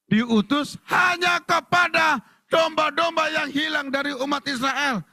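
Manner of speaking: high, slow, ringing declamation; forceful proclamation. Acted. Jesus answering the Canaanite woman, high forceful proclamation. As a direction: proclaim the verse with long-drawn words. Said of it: "Yesus malah ngomong gini".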